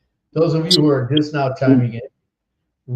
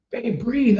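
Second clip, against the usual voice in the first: higher-pitched urging to a wife; retold coaching husband; 9 semitones higher than usual. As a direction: replay your own delivery-room coaching. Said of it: "I looked at her and I said".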